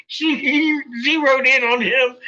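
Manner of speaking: high-pitched, stumbling through laughter; laughing while telling. Real, not acted. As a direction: fight laughter to finish the line. Laughing as he retells the story.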